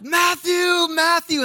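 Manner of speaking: high, loud, desperate calls of a name across the beach; frantic calling. Retold or imitated. Father searching the beach, calling desperately for his son. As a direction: call out for a lost boy, rising in panic. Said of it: "He's like".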